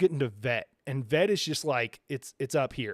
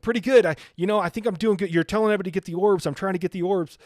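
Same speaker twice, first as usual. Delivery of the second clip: higher, louder mock-earnest reply; quoting a player, raised voice; imitated clueless player; 6 semitones higher, 6 dB louder, faster than usual. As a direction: voice another player, pitched up and loud. Acting out the confident player's reply.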